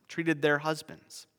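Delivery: normal talking voice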